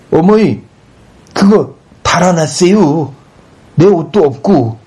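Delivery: high-pitched whiny wail to mother; whining. A narrator's reading. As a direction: whine in distress like a son robbed. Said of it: "징징거린다". (어머니에게 징징거리듯)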